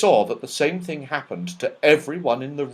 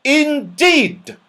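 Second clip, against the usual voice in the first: put-on high, loud furious old dame; fierce loud snap; 8 dB louder than usual. A narrator's reading. Cross dame catching naughty pixies, fierce and loud. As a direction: snap it out to scare the room. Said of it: "said Dame Snap".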